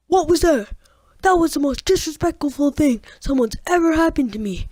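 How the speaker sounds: outraged teacher. Retold or imitated. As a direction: ham up his wounded outrage. Outraged teacher quoted in story.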